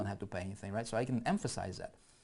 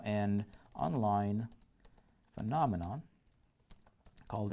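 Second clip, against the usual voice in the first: slow dictation; slower than usual. Acted. Dictating copy while typing.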